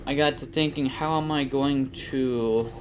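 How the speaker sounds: ordinary talk, nothing marked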